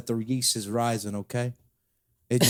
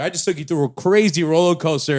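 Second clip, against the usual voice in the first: podcast host intro; 3 semitones higher, 6 dB louder than usual. Acted. Host hyping the episode to listeners, loud announcer voice. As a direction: boom out the episode number like a host.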